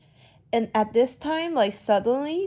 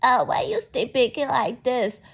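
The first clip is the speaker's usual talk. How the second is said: higher-pitched mimic of a critic; mock someone's way of speaking in a higher voice; mocking imitation; 4 semitones higher than usual. Woman in the chat room making fun of an accent, mocking. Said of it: "She just making, like".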